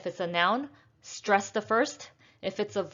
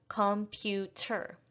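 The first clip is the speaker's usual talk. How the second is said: computer slowed into com-pu-ter, softer; syllable by syllable; 5 dB quieter, slower than usual. Shown as a demonstration. Teacher counting syllables for learners, syllable by syllable. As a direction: softly count out the three beats of computer. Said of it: "we have three syllables".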